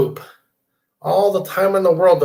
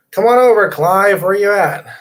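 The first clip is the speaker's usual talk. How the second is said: higher, louder invitation; calling out; 4 semitones higher, 6 dB louder than usual. Real, not acted. Host calling out to guest Clive to join. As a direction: summon him across the room.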